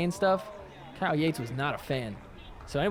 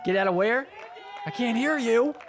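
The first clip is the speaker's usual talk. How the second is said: mock taunting; 7 semitones higher than usual. Commentator voicing animated player's retort, mock taunt. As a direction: play at being hard of hearing to taunt.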